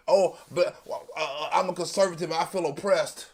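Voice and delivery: low, soft mocking whine; a lightly voiced self-pitying conservative; quiet mocking lament about oppression; mock conservative victim. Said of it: "I have never heard that energy".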